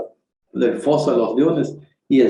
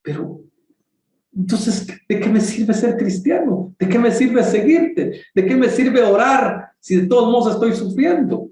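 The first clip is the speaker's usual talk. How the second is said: protesting complaint; 10 semitones higher than usual. Retold or imitated. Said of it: "le protesta".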